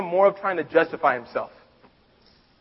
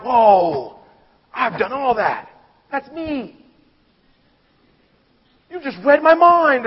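higher, marvelling voice rising to a louder peak; excited amazement; 7 semitones higher, 4 dB louder, much slower than usual. Retold or imitated. Rich young ruler hearing the commandments, excited amazement. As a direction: gush as if Jesus read his mind. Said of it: "he's kinda feeling like".

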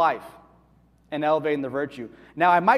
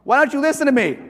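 loud, higher exasperated question; exasperated parent; 8 semitones higher, 7 dB louder than usual. Retold or imitated. Pastor mimics a parent exasperated with a child, loud and high. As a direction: snap it like a parent at wit's end. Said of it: "Give you one more".

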